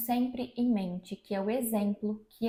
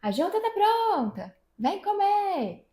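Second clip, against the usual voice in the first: high, drawn-out holler through the house; loud singsong dinner call; calling from another room; 10 semitones higher, 4 dB louder, slower than usual. Acted. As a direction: call everyone to the table from rooms away. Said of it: "falando alto, falando de um cômodo".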